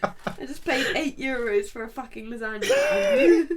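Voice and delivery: laughing while telling it; laughing outrage. Real, not acted. Laughing about paying for lasagna.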